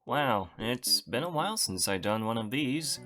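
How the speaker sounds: ordinary delivery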